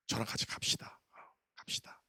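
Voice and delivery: hushed whisper, "let's go". Retold or imitated. Pastor whispers to the evangelist to come with him. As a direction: whisper the call to come along. Quietly calling a staff member.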